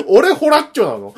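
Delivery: incredulous, laughing protest. Real, not acted. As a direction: laugh it out in disbelief.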